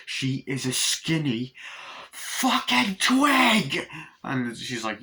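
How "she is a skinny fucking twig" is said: slow rant building to a high, loud yell; acted furious outburst, stretched and rising; exasperated yell. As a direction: draw each word out, then scream the last two.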